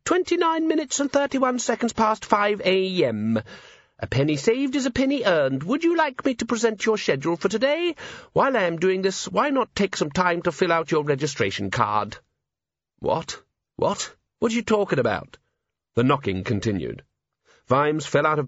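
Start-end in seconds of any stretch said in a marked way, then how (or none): 0.0-12.2 very high, chirpy talking organiser; cheerful gadget voice. A narrator's reading. Gadget reading time and schedule to sleepy owner, cheerful and high. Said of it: "said a cheerful voice". 13.0-15.4 high-pitched, bewildered questions; groggy bafflement. A narrator's reading. Just-woken man questioning a chattering gadget, bewildered.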